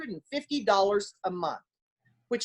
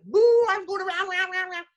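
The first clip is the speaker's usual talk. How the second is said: high, loud thrill of running the bases; excited; 10 semitones higher, 3 dB louder than usual. Retold or imitated. Player rounding the bases on a triple, excited and high. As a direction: let it burst out as you round the bases.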